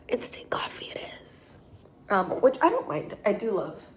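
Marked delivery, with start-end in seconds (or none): 0.1-1.6 a soft, resigned whisper; whispered resignation. Real, not acted. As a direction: whisper it, giving in. Resigned aside after broken machine.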